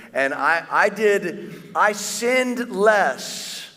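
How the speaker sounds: rising, slowed, self-satisfied boast; mock a proud churchgoer bragging; hammed-up smug voice; smug self-praise.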